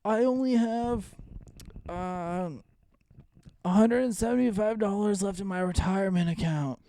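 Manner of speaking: put-on high, slow, complaining old man. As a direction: grumble about money like a pensioner. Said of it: "you like my old man voice".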